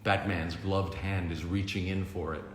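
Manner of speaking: ordinary delivery, nothing marked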